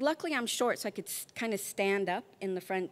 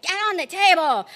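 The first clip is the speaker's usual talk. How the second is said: loud, higher mock nurse order; barked order; 7 semitones higher, 10 dB louder than usual. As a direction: bark it like a no-nonsense nurse. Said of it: "And she's like".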